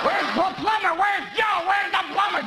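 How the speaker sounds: high, excited half-shouted ramble; rambling uncle. Retold or imitated. McCain as a drugged uncle shouting to mall shoppers, high and excited. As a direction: play a giddy uncle lost in the mall. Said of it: "wandering around the mall going".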